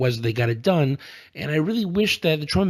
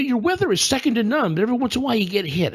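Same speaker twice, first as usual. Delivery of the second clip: quoting Trump; 8 semitones higher, faster than usual. Retold or imitated. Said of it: "he also told them".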